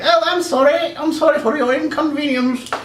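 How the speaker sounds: high, put-on customer-service lady; mock-polite high lady voice; apologetic clerk lady. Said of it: "this was a different lady altogether".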